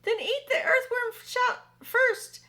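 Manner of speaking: high, loud, slow shout of a bug voice; shouting centipede. Acted. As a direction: yell it as the panicked centipede. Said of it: "shouted the centipede".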